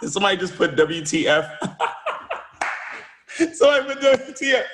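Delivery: high and laughing through the words; can't stop laughing as you tell it. Real, not acted. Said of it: "I started laughing".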